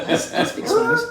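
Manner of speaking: high, loud, laughing eager blurt; excited blurt. Retold or imitated. Pitching himself to the producers, high and laughing. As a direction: jump at the chance, laughing. Eager reply in retold meeting.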